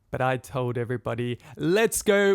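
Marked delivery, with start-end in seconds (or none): 1.6-2.4 high, loud burst of bravado; rallying call. Retold or imitated. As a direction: call out to rouse the whole group. Rallies everybody to jump.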